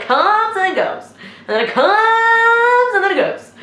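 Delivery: high, stretched-out "comes"; drawn-out demonstration. Retold or imitated. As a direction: draw out the motion word in a high sustained pitch. Acting out the head advancing and retreating.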